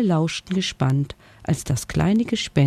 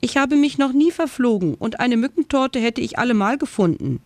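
higher, cheeky little bat boasting; squeaky bat; 8 semitones higher than usual. Acted. Bat Frieda boasts she never gets lost, high and cheeky.

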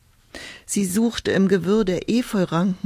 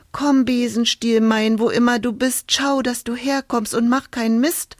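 calling out; 5 semitones higher than usual. Acted. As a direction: call the broom like a spell. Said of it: "rief sie".

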